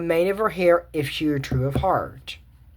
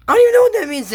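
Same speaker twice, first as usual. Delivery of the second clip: loud, high-pitched outburst of bafflement; exasperated, voice shooting way up; 7 dB louder, much faster than usual. Real, not acted. Exasperated over baffling old-fashioned dialogue.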